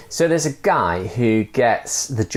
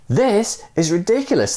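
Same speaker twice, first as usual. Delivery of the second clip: high, fed-up inner voice; a hint of disbelief; exasperated thought; 11 semitones higher than usual. Man struggling with the rope, mildly exasperated. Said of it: "the man thought".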